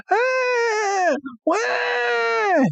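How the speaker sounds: very high, wailing creature cry; Lapras cry. Retold or imitated. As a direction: wail like a distressed sea creature. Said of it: "And you hear".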